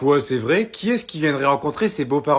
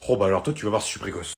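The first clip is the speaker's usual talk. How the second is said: ordinary delivery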